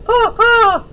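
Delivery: high loud cawing crow; crow caws. Acted. Opal imitating a crow for friends, loud high caws. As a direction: caw out like a crow. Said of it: "imitated ... a crow".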